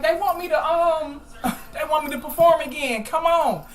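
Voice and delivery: laughing joyfully. Retold or imitated. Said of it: "I'm coming out there like".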